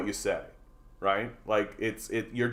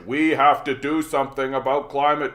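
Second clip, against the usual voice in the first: mock politician pledge; 4 semitones higher, 7 dB louder than usual. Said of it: "say back to them the thing they said to you".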